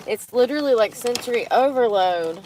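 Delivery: ordinary delivery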